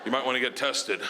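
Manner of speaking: low, hesitant deadpan; hesitant bad news. Producer telling man what the bear did, hesitant and deadpan.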